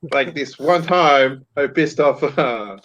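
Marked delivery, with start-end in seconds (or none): none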